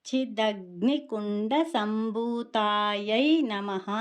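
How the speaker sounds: చిదగ్నికుండ సంభూతాయై నమః slow and drawn out; measured devotional recitation, long vowels in సంభూతాయై; namavali recitation. Shown as a demonstration. Teacher shows the namavali form of the name, slow recitation. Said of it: "నామావళిలో చూసుకుంటే కనుక".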